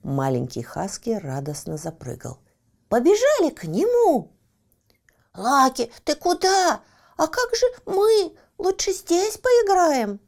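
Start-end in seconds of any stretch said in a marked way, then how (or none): 2.9-4.3 high eager shout to friends; excited puppy call. Acted. Щенок зовёт друзей. 5.3-10.2 squeaky anxious small friend; high worried little animal. Acted. Друзья отговаривают Лаки.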